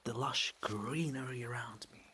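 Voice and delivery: soft whispered ASMR chat; soft whispering vlog aside. Real, not acted. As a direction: whisper gently over the rain. Quiet ASMR-like outdoor aside.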